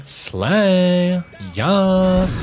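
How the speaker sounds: high, loud sassy cheer; sassy slay voice. Pretend. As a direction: hype it up like a diva. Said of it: "Can you do a slay with your great voice acting?".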